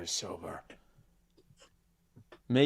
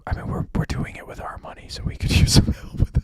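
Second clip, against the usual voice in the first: whispering; 7 dB louder than usual. Pretend. Whisper segment.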